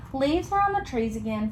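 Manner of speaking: usual speaking voice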